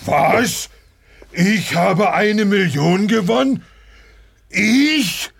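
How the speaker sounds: rising high disbelief; astonished. Acted. Realises he won.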